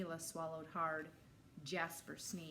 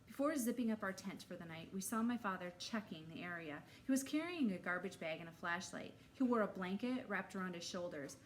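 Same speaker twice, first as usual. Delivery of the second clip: usual speaking voice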